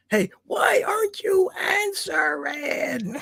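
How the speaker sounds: high, slow, breathy mock whisper; hammed-up desperate guy; mock creepy texter. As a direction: play the needy texter as a spooky whisper.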